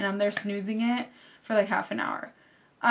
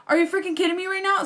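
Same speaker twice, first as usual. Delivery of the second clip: higher, fast exasperated outburst; frantic disbelief; 7 semitones higher, faster than usual. Retold or imitated. Racing octopus lady to the door, fast frantic disbelief. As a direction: rattle it off racing her to the door.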